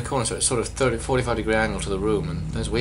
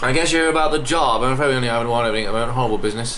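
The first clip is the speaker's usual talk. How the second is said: loud English job-recruiter voice; English accent; 2 semitones higher, 5 dB louder, faster than usual. Acted. Telling a job applicant of one opening, English accent. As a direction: play a courteous, brisk English greeter. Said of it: "I don't know why he's English".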